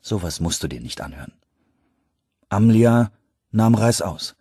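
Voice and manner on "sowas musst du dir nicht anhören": soft, low, friendly dismissal. A narrator's reading. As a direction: gently send her away, voice lowered. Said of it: "sagte er in freundlichem Ton". (freundlicher, leiser Ton)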